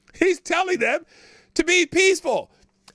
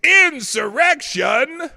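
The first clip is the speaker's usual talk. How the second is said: slow, loud, higher shout of Insurrection; booming echoed shout; 4 semitones higher, 8 dB louder, much slower than usual. Shown as a demonstration. Host finds the right echo and booms it, slow shout. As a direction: boom the word out like a dramatic announcer. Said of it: "Number five".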